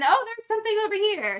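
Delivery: high, bright ditzy musing; bumbling Roomba voice. Retold or imitated. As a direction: wander into a new thought, surprised. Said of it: "like, Roomba of thought".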